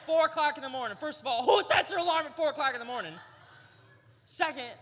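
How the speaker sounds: high, loud, fast outraged ranting; comic outrage at an alarm clock; exasperated rant. Retold or imitated. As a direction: vent like you were jolted awake at four a.m. Woken at 4am, ranting at sister.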